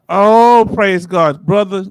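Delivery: a burst of loud joy at the news, then praise; joyful outburst. Real, not acted. Host hears viewer's good news, joyful loud praise. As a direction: shout oh out, then bless him.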